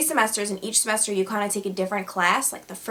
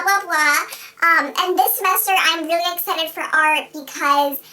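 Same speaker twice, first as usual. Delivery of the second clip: high, loud caricature of a student; Victoria; 9 semitones higher, 4 dB louder than usual. Retold or imitated. Student introduces herself to a teacher by email, high-pitched mock voice. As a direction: put on a squeaky introduction voice with giggles breaking in. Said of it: "her little email went a little something like this".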